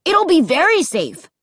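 put-on high, insistent boy; eager child's firm promise; declaring. A narrator's reading. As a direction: assure them emphatically, pitch up. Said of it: "Luke declared".

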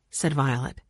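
ordinary delivery

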